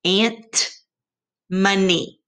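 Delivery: "Ant, money" with the different endings set apart; contrasting endings. Shown as a demonstration. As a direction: let the mismatch be heard. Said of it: "doesn't sound the same".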